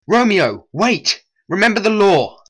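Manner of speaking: loud urgent call; urgent shout. Acted. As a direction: shout him down before he strikes. Stopping Romeo from revenge.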